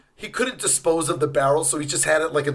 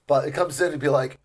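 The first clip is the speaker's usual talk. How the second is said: ordinary talk, nothing marked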